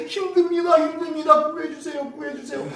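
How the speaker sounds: high, pleading lament; high keening plea for rescue; wailing. Retold or imitated. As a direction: plead like a weeping captive. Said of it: "막 통곡하면서".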